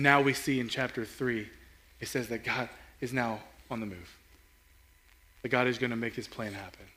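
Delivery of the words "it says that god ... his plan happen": ordinary delivery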